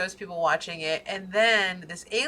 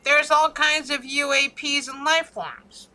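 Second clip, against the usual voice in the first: mock official announcement; 6 semitones higher, 6 dB louder, slower than usual. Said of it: "and it's like".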